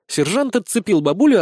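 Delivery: ordinary delivery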